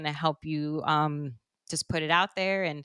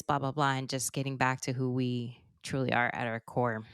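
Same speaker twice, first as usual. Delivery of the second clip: normal talking voice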